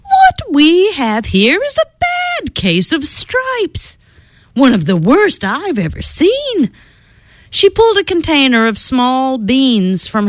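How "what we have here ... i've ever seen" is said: higher, lightly voiced kindly old lady; a hint of a cheerful old healer; old woman. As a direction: diagnose it like a sweet grandma doctor. Old woman diagnosing the stripes.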